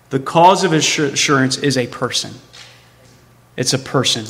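ordinary delivery

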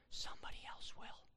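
whispered warning. Acted. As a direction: lean in and whisper it.

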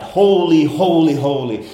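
loud, high cry fading over the three words; angels crying out. Acted. Preacher voicing angels crying out, loud high call. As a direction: proclaim it like the angels. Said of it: "the angels cry out".

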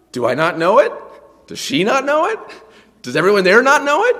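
loud questions, each soaring high at the end; mock incredulous. Preacher on saying his own name, mock incredulous. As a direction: ask as if it were absurd, climbing.

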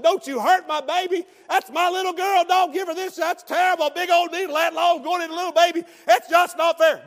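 high, loud, frantic mock protest; hammed-up outraged daddy pleading; protective father protesting. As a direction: wail in indignation for your baby. Protective father protesting daughter's shot.

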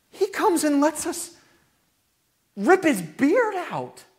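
high, loud preacher fervour; impassioned preaching. Acted. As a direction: proclaim it with rising wonder.

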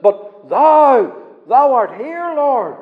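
preacher voicing David's bold reply; emphatic prayer. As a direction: proclaim it against the doubters. David answers the doubters.

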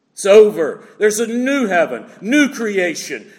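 ordinary talk, nothing marked

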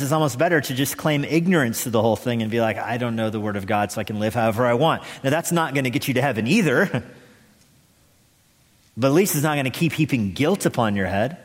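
normal talking voice

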